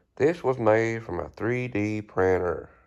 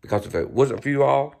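ordinary delivery

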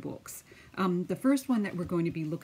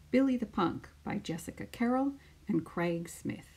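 put-on lively storytelling voice for children; picture book read-aloud; 4 semitones higher than usual. Teacher reading a picture book to her class, lively storytelling. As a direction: read it out like story time on the mat.

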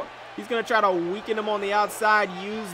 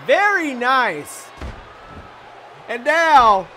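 excited shout; 4 semitones higher, 8 dB louder, slower than usual. Real, not acted. Big lariat move.

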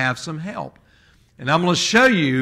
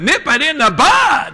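Barney Fife impression; 8 semitones higher than usual. Deputy jumping up to shout advice, in a high excited yell. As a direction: yelp it like a jumpy small-town deputy. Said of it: "in the voice of Barney Fife".

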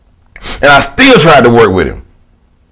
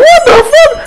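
very high squeak of disbelief; shocked. Retold or imitated. Wrestler hearing the crowd's threat, squeaking in shock. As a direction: squeal it like you can't believe your ears. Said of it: "I'm like".

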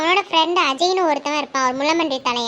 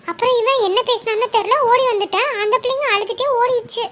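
higher, louder auntie spilling gossip; gossiping village woman; 6 semitones higher, 4 dB louder than usual. Acted. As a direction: tell it with relish, pitched up. (உயர்ந்த குரலில் வம்பு பேசும் பெண்)

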